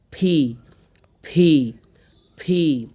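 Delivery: P as loud, puffed "phee"; aspirated P. Shown as a demonstration. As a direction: repeat "phee", bursting air on P. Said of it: "তো উচ্চারণটা কী হবে".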